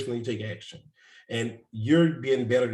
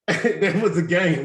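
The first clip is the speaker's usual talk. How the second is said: laughing; 5 semitones higher, 6 dB louder, slower than usual. Real, not acted. Laughing at the mentor's lesson.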